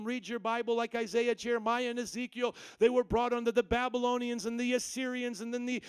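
ordinary talk, nothing marked

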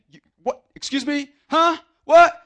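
rising into a loud, high What; stunned, escalating outburst; shocked disbelief. Retold or imitated. Samaritan woman stunned that Jesus spoke to her, shocked. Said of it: "She's just like".

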